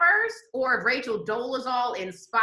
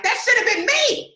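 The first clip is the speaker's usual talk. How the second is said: voicing her envy, pitched way up and louder; jealous mock whine; 11 semitones higher, 6 dB louder than usual. Woman watching the headlines, mock jealous high whine. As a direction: play the jealous rival, high and loud.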